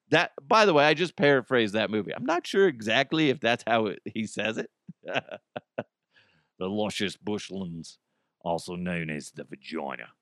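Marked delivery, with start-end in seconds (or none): 5.0-10.1 slow, low Australian murmur; Australian accent. Retold or imitated. Host redoing a movie line for laughs, slow Australian accent. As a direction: draw it out softly in an Australian accent. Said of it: "I say vagina in an Australian accent".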